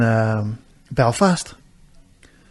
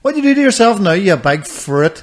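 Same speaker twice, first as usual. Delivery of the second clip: mocking acquaintances; 6 semitones higher, 4 dB louder than usual. Everybody teasing him about his brace, mocking jeer. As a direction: jeer high, then stretch the name-calling. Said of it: "everybody goes like".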